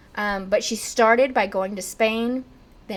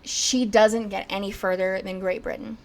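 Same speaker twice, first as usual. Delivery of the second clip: usual speaking voice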